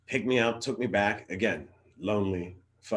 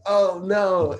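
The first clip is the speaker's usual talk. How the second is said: high, loud cry of dismay; hearing the date cancels last minute; mock dismay; 11 semitones higher, 9 dB louder than usual. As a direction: wail it like the date just fell through.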